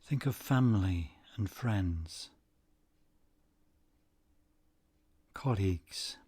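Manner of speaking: unhurried, soothing delivery, letting each phrase settle; guided meditation. Acted. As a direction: guide them gently into stillness, phrase by phrase.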